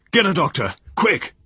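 loud, high call for help; urgent shout. A narrator's reading. General Barnes shouting for a doctor, loud and urgent. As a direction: yell for a doctor. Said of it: "General Barnes cried out".

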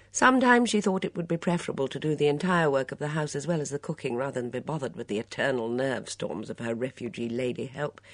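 ordinary delivery, nothing marked

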